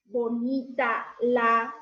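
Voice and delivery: slow, word by word; slow jumbled sentence. Shown as a demonstration. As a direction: read it out slowly as a jumbled sentence. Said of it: "¿me entenderían?".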